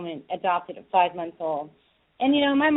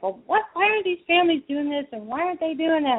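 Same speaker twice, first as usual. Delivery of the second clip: higher-pitched retold exasperated questioning; 6 semitones higher than usual. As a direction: imitate a caller's exasperated questions. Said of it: "she was so exasperated".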